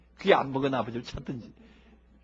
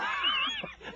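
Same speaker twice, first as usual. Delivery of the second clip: high laugh. Real, not acted. Laughing mid-quote.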